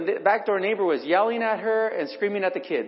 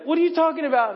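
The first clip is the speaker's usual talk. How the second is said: sharp, much higher and louder challenge; angry retort; 10 semitones higher, 4 dB louder than usual. Retold or imitated. Cut off by a cussing driver, he fires back angrily. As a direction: snap back at the rude driver, ready to fight. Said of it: "I'm ready to".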